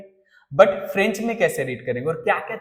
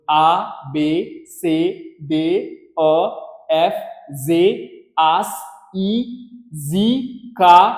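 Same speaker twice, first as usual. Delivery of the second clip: very slow, louder spelling for the class to repeat; slow read-along; 4 dB louder, much slower than usual. Shown as a demonstration. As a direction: spell along slowly so the class can follow. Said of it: "एक बार और मेरे साथ read कर लो".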